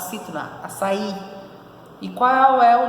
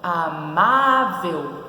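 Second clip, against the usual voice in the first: Amável with a long, strong MÁ; stressed syllable stretched; 2 semitones lower, much slower than usual. Shown as a demonstration. Teacher locating the tonic syllable, Amável with MÁ drawn out. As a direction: hold the MÁ in Amável, very slowly. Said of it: "Qual é a posição da sua sílaba tônica?".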